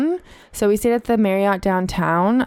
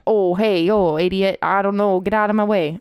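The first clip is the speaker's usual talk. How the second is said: half-hearted Boston bartender; lightly faked Boston accent, close to your own voice; attempted Boston accent. Shown as a demonstration. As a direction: have an unsure go at a Boston accent. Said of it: "Let's hear your Boston accent".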